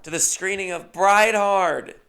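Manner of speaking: high, slow emcee welcome; host on the mic. Retold or imitated. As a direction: boom it out like a party host. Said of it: "And she goes".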